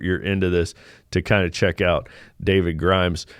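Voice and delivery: ordinary delivery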